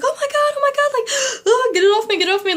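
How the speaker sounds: high panicked shrieking over a bee; frantic oh my God, near tears; freaking out. Retold or imitated. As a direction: retell the bee panic, squealing with a gasp.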